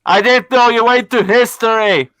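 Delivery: cupped hands making a tube echo; echo through hands. Shown as a demonstration. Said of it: "holding it like a tube around my mouth".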